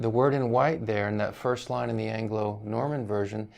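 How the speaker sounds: normal talking voice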